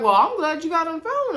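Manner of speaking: high, loud mock shock; high-pitched laughing shock. Real, not acted. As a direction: squeal your disbelief. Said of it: "and I'm shocked".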